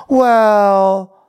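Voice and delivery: very high, loud, endlessly stretched words; hammed-up wailing confession, painfully drawn out; Victoria. Victoria admitting her date is a girl, high stretched mock wail. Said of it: "So Victoria's like".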